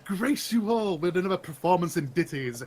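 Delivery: theatrical, self-important bard; grand performer. Acted. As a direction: proclaim it like a pompous showman. Plays a grand performer character.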